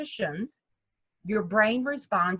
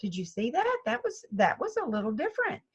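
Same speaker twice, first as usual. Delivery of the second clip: high, soft delighted wow; mock amazement at a good shot; lightly surprised golfer; pleasantly surprised; 7 semitones higher, 3 dB quieter than usual. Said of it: "the second shot is like".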